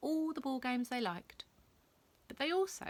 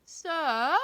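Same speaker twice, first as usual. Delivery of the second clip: higher, louder call from a doorway; 8 semitones higher, 7 dB louder than usual. Acted. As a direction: call across the corridor. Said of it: "called out Rebel".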